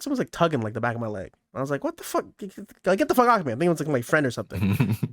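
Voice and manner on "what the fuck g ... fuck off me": high, sharp mock yell at whatever grabs him; startled yell. Boy in the pool snapping at someone tugging his leg, high. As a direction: shake it off, annoyed and startled. Kid yanked by unseen grip.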